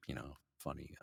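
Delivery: ordinary delivery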